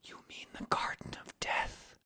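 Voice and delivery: breathless whisper. A narrator's reading. Virginia whispering to the ghost in fear. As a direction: whisper it in dread. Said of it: "she whispered".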